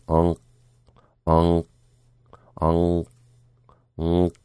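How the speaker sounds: ong ringing in the nose, clipped by a silent k; nasal ong. Shown as a demonstration. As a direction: hum ong through the nose then close it off. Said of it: "So ong, sound coming out your nose".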